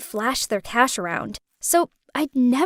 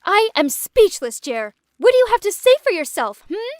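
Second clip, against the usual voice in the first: mock scream; 7 semitones higher, 4 dB louder than usual. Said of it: "Naomi screamed".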